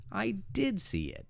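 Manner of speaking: "did" thrown high, then dropping on "see it"; stress on DID. Shown as a demonstration. Teacher demonstrating emphasis, "did" high and stressed. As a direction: make "did" the high peak of the phrase. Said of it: "It's to stress that you did".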